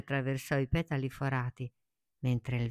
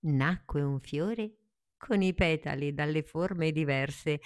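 ordinary delivery, nothing marked

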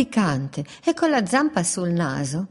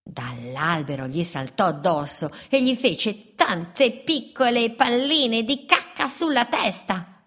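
ordinary delivery

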